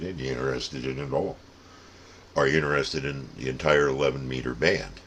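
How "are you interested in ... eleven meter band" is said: usual speaking voice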